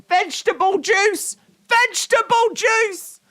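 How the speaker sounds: very high, breathless laughing squeal; wheezy voice. Real, not acted. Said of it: "I can't get my breath".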